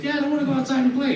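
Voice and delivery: put-on high pleading child voice; whiny kid. Son begging his dad to go outside, high childish whine. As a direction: beg like a little boy asking to play.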